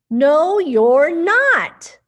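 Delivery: firmly slow the denial; loud slow retort. Acted. Animal contradicts mouse loudly.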